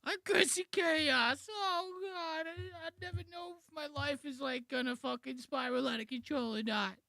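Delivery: very high, whiny mock panic; Chris Di Stefano impression. Reactor mocking comedian's ruined bit, high fake-distressed whine. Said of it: "ruin it with this whole".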